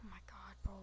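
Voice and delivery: hushed scared whisper. Real, not acted. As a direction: breathe it out under the breath. Hiding, whispering in fear.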